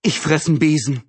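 mildly astonished outburst; astonished exclamation. A narrator's reading. George spots something out of the window, astonished. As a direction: exclaim in disbelief.

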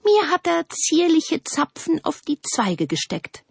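very high, dainty little voice; calling out. A narrator's reading. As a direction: pipe up high as the larch. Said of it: "rief die Lerche". (hoch und zierlich rufend)

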